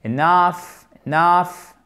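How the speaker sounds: enough with the weight on nough; stress on nough. Shown as a demonstration. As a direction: push the second syllable of enough. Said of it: "accent tonique sur la seconde syllabe".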